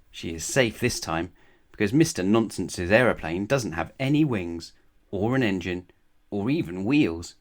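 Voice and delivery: ordinary delivery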